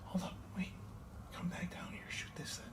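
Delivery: urgent hushed whisper. Real, not acted. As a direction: whisper the order tensely. Hearing something in the dark.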